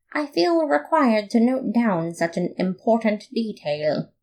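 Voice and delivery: ordinary delivery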